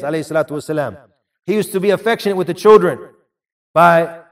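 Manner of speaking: normal talking voice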